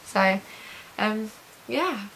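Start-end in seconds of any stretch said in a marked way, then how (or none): none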